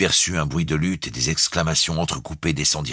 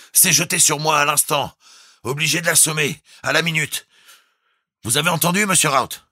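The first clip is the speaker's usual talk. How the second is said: high, raised, telegraphic report; urgent calling out; 13 semitones higher, 4 dB louder than usual. A narrator's reading. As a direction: report the attack down the tube. Captain reports attack through tube. (rapport urgent à voix haute)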